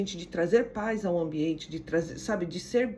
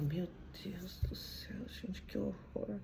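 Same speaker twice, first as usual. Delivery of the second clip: hushed, breathy aside; shocked whisper; 3 semitones lower, 13 dB quieter than usual. Retold or imitated. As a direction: whisper it as if appalled by the noise. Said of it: "E aí tem gente que fala".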